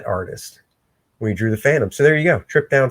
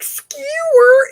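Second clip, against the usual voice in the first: mock stab effect.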